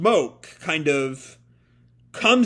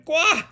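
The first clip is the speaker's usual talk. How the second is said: comic yelp; 13 semitones higher than usual. Acted. Ellie falls onto the scooter.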